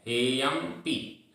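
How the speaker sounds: हेयम पी split, slow, पी apart; wrong pronunciation. Shown as a demonstration. Teacher shows how not to say it. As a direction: pause wrongly between हेयम and पी. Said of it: "ऐसा नहीं बोल रहे हैं".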